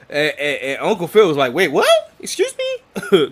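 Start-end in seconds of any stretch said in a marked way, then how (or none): 1.5-3.3 high, loud, incredulous question; shocked disbelief. Retold or imitated. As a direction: balk at what was said, pitch shooting up. Uncle baffled by nephew's slang.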